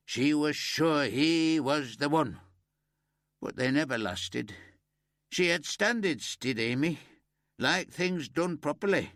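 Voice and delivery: put-on an old man praising the girl who helped him; grieving old Yorkshireman. A narrator's reading. Old neighbour gossiping.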